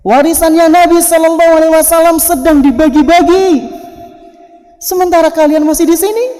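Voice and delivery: high, loud proclamation; calling out. Acted. Abu Hurairah tells the market of the inheritance, calling out. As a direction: cry the news out to everyone. Acting the cry, translated.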